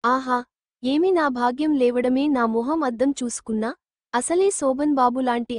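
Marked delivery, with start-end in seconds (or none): none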